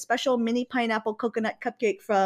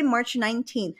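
normal talking voice

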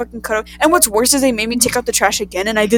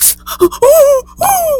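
high, loud hammed-up gasp; mock gasp; 7 dB louder than usual. Daughter mimicking her mom's horrified gasp. Mom reacting to blood.

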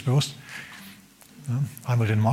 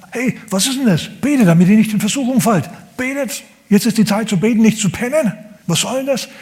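higher, scolding rebuke to sleeping disciples; Jesus scolding; 8 semitones higher than usual. Retold or imitated. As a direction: scold them like a disappointed master. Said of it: "Und Jesus schimpft".

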